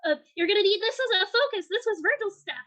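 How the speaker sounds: high, loud, quick character voice; excitable game character. Acted. Game master as Depp handing over a staff, high and urgent. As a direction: pitch it up and press it out urgently.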